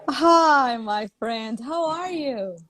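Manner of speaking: high, loud, effusive welcome; excited high-pitched greeting; delighted greeting. Real, not acted. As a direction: gush hello to a dear friend. Greets friend who just joined.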